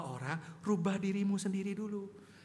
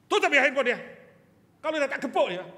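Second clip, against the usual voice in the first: put-on loud, raised threat to a child; stern threat; 5 semitones higher, 13 dB louder, much faster than usual. Father ordering his child off the phone, loud threat. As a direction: bark it like a strict father. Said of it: "Gitu ngomongnya".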